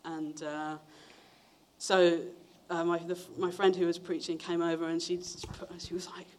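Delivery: usual speaking voice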